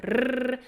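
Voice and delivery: rolled rrr on the tongue; tongue trill. Shown as a demonstration. Voice coach offers an easier option, tongue trill. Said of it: "like a tongue trill".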